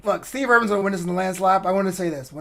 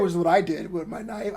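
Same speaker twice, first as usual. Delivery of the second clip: usual speaking voice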